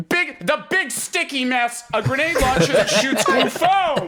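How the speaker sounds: high, stretched vendor voice punching FOAM; nervous vendor's pitch. Retold or imitated. Podcaster replays the vendor's seven-second line for laughs, high-pitched pitch. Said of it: "places the emphasis on foam".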